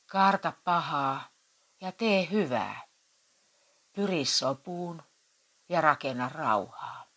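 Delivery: slow, solemn Bible reading; scripture reading. Acted. Vicar reads the psalm to the church council, slow and solemn. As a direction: intone it like a pastor.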